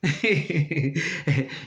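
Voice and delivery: laughing. Real, not acted.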